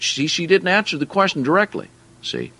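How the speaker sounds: ordinary talk, nothing marked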